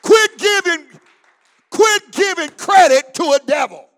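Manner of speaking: loud, ringing preacher's shout; full-force preaching. Acted. Preacher rouses the Holy Ghost filled saints, shouting. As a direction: whip the saints up to their feet.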